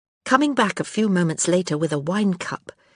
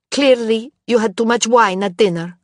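cold; 5 semitones higher, 6 dB louder than usual. A narrator's reading. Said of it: "Pietro said coldly".

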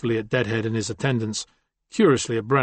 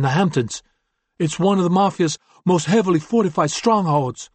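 higher, louder briefing of the gang boss; Fade Out's voice; 7 semitones higher, 4 dB louder than usual. A narrator's reading. Fade Out briefs Suma on Covello's stronghold, voiced high and loud. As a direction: lay out the enemy defenses, pitched up and pressing.

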